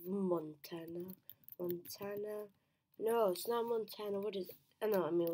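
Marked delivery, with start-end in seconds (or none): none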